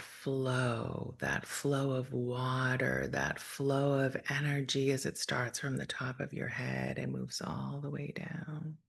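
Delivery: calm, measured meditation voice with long pauses; guided meditation. Real, not acted. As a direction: gently guide listeners through breath and imagery, unhurried.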